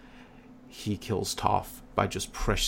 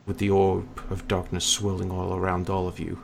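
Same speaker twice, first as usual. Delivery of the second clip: usual speaking voice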